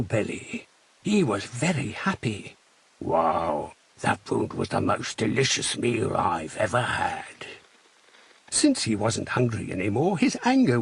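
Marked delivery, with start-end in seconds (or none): 3.0-7.5 much lower, satisfied wolf voice; a full-bellied wolf sighing happily, lower; contented wolf. Acted. Wolf character speaks, full.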